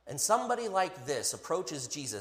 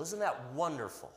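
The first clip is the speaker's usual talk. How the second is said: ordinary talk, nothing marked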